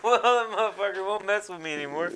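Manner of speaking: giggly smug retold quote; laughing smug quote. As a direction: crack up while voicing the smug line. Voicing the dad's smug line.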